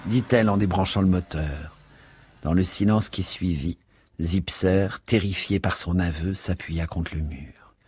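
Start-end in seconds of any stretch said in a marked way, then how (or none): none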